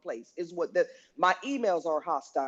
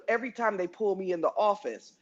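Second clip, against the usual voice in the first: ordinary talk, nothing marked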